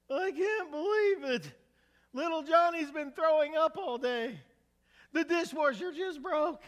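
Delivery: high, loud frazzled wife yelling; exasperated wife. Acted. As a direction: let it all burst out at him, high and loud. Wife's outburst in a joke.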